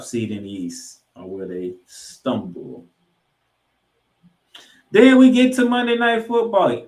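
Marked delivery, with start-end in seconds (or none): 4.9-6.9 big booming announcer call; announcer hype. Real, not acted. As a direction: belt it out like a game-day announcer.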